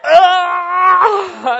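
high, loud laughing scream. Retold or imitated. Falling down the tower, a loud laughing scream. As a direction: let out a full scream on the drop. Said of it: "I just scream".

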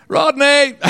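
high, loud teasing call; teasing a church parent by name; calling out playfully. Pretend.

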